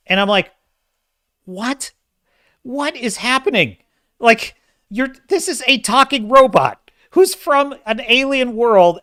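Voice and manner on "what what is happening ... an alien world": high-pitched, baffled outburst; stunned disbelief, voice pitched right up; incredulous. Retold or imitated. Film reviewer retelling his reaction to an absurd scene, incredulous.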